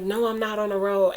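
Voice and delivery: frustrated. Retold or imitated. Answering her sister's call about the road, frustrated.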